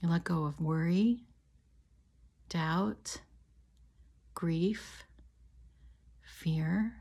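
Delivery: calm, measured meditation voice with long pauses; guided meditation. Real, not acted. Healer leading listeners on an imagined walk to a river, guided meditation. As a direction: guide them gently, leaving space after each phrase.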